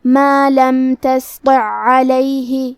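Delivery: تسطع علیہ with the two ع merged into one doubled ع, heavy ط, slow; tajweed recitation. Shown as a demonstration. Teacher demonstrating idgham of ع to students, slow recitation. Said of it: "عین کو دوسرے عین میں ملا کے".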